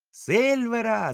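high, loud, drawn-out cry; crowd shout. Acted. Pirates shouting for Silver, loud and high. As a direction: shout the name slowly as a crowd. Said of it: "закричали всі".

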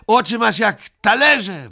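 high, loud taunting yell; a child's mocking shriek. A narrator's reading. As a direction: scream the insult at him. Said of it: "wrzasnął Ryjek".

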